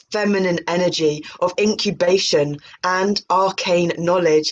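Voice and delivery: normal talking voice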